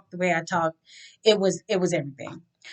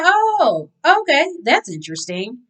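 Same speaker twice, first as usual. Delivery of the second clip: high surprised oh; 5 semitones higher than usual. Retold or imitated. Meeting James, surprised.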